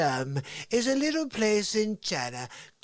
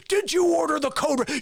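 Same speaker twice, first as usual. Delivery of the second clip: high, forceful demand; shouted interrogation; 7 semitones higher, 4 dB louder than usual. Acted. Lawyer breaking the colonel, loud and demanding. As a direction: explode into the question. Lawyer's question, now exploding.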